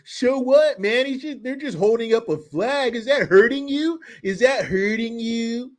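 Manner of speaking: high put-on taunting voice; mocking challenge. Answering a viewer upset about a flag, mocking.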